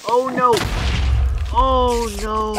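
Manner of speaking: high, loud cry of dismay; alarmed cry. Real, not acted. Player reacting to a sudden game mishap, alarmed cry.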